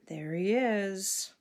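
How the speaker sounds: ordinary delivery, nothing marked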